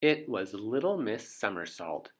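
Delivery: ordinary delivery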